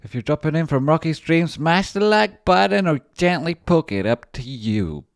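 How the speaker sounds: high, loud announcer call for likes; hyped streamer plug. Acted. Streamer hyping viewers to smash like, loud announcer. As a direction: sell the like button big.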